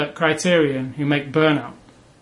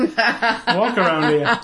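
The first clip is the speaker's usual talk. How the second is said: laughing; 4 semitones higher, much slower than usual. Real, not acted. Laughing while telling the story.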